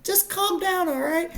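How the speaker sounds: very high, softened mock girlfriend voice; soothing girlfriend. Imitating girl keeping her man in check, high soothing voice. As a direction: soothe him in a put-on falsetto. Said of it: "this girl was like".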